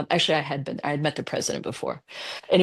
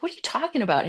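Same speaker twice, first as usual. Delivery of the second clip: incredulous; 9 semitones higher, much faster than usual. Retold or imitated. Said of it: "I'm like".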